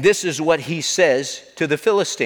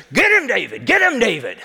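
high, raised rooting cry; root hard for your fighter; acted rallying shout, pitched up; cheering crowd yell; 6 semitones higher than usual.

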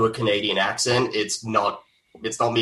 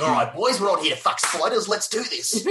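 higher, hammed-up Aussie bogan voice; bogan Australian; 8 semitones higher than usual. Host imagines leaning into bogan slang, hammed-up Australian bogan. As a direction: ham up the broad Australian bloke. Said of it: "lean into the bogan".

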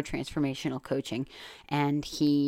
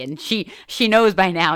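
ordinary delivery

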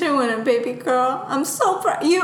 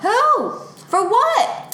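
much higher, sharp demanding questions; indignant demand; 10 semitones higher, much slower than usual. Acted. Mother demanding who insulted her daughter, indignant higher questions. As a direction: wants names right now.

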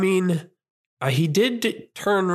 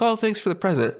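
higher, polite fake gratitude; fake polite thanks; 8 semitones higher than usual. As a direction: thank them through disappointment. Kid politely thanking for gift.

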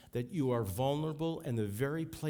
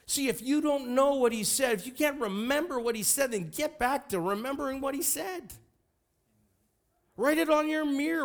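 high-pitched, urgent preacher's exhortation; full-force preaching; 12 semitones higher, 5 dB louder than usual. Real, not acted. Preacher urging people to remember what he said, impassioned. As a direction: press the congregation hard, voice pitched way up.

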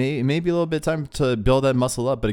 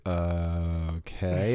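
low, soft and unwilling; reluctant; 6 semitones lower, 6 dB quieter, much slower than usual. Retold or imitated. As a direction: drag out a doubtful "uh, okay". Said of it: "so reluctantly".

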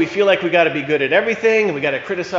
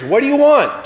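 a higher, slightly louder call over the bridge; man on the bridge calls down to the stranger, mock yell; 8 semitones higher, 3 dB louder than usual. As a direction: call down the drop, pitched up high. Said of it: "he yelled over the bridge".

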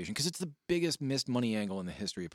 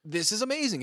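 much higher, awed exclaim; amazed; 10 semitones higher, 5 dB louder than usual. Retold or imitated. Recalling watching Sandman caning people, high amazed cry. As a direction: marvel out loud. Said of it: "And I'm like".

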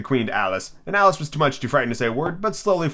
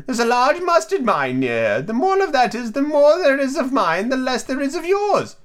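put-on very high, slow, prim old lady; Duchess voice; 12 semitones higher than usual. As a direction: hold the Duchess's high sing-song. Duchess voiced in read story.

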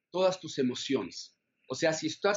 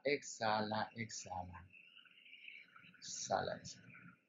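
exhala fading to a whisper; fading exhale cues; 6 semitones lower, 13 dB quieter than usual. Shown as a demonstration. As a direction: let each exhala trail away softer. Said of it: "Vamos a hacerlo".

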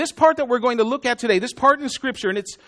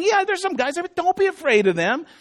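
offhand casual God; 6 semitones higher than usual. Pretend.

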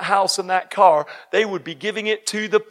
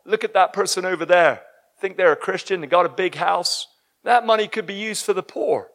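ordinary talk

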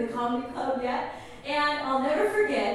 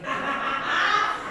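high, gloating cackle; playful villain's laugh; mock evil laugh; 8 semitones higher than usual. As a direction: laugh like a scheming villain, in jest. Joking gloat over beating the pastor.

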